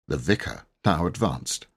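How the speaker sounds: plain reading voice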